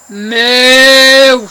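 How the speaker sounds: loud put-on cow moo. Pretending to be a cow for people, loud moo. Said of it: "I'll just be like".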